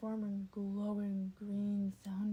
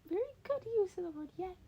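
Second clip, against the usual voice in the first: high, fast and louder proclamation; higher, quicker character voice; proclaiming Nezu; 10 semitones higher, 6 dB louder, much faster than usual. A narrator's reading. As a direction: announce it like a pleased little professor. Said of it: "Nezu proclaimed with a single raised finger".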